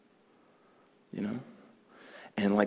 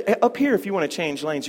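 ordinary delivery

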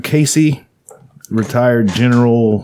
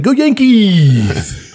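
mock cheer; 9 semitones higher, 3 dB louder, much slower than usual.

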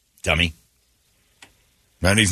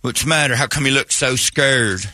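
hillbilly surgeon; 3 semitones higher than usual. Acted. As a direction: ask the patient like a backwoods hillbilly doctor. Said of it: "I've got a hillbilly about to cut me".

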